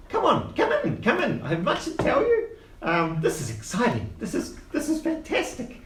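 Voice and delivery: put-on high old gnome voice, eager; squeaky little uncle selling a job. GM voices Quince.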